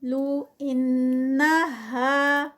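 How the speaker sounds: انہا with ghunna held; slow chanted; tajweed recitation. Shown as a demonstration. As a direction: recite nasal two counts. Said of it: "ان کا یہاں پر غنہ ہے".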